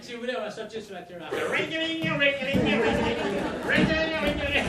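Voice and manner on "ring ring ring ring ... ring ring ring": high, loud sung ring ring; phone ring imitation. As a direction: chime it like a phone.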